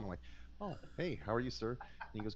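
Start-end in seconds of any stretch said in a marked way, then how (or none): 0.6-1.7 surprised politeness. Retold or imitated. Surprised call from Doctor Tom.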